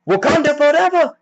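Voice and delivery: high-pitched rallying cry; battle cry. Retold or imitated. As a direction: shout it like leading a charge.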